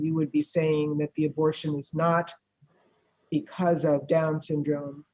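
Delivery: ordinary talk, nothing marked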